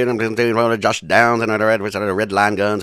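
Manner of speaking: fast, loud auction patter; auctioneer. Acted. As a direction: rattle it off like an auctioneer. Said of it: "T-Bone auctioneer style".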